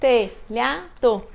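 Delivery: "Telhado" in three slow, separate beats, te-lha-do; syllable by syllable. Shown as a demonstration. Teacher recounting telhado's three syllables, syllable by syllable. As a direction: count out "Telhado" slowly, a gap after each syllable. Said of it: "a palavra telhado tem três sílabas".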